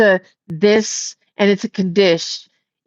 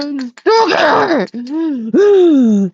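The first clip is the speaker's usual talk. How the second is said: higher, emphatic, here dragged out; exclaimed complaint; 4 semitones higher, much slower than usual. Real, not acted. Repeats stuck here, exclaiming.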